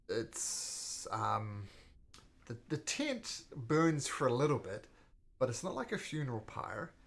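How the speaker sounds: usual speaking voice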